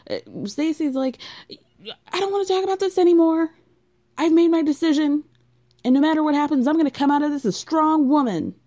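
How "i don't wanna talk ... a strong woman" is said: high, loud, fast declaration; defiant. Retold or imitated. Stacy's defiant speech.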